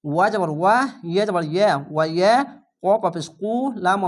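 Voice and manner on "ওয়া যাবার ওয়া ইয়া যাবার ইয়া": ordinary talk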